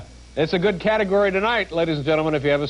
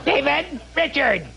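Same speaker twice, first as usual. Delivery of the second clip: imitating the page calling; 6 semitones higher, slower than usual. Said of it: "it took some years off my life".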